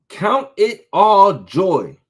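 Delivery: slow, loud count it all joy; drawn-out preacher emphasis; emphatic scripture. Acted. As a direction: lean hard on each word. Preacher stressing scripture phrase.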